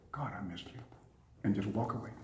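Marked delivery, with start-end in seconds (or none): 0.1-0.8 very soft, low and intimate; tender homecoming murmur. Shown as a demonstration. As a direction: say it tenderly to your wife. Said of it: "walk in the house and say".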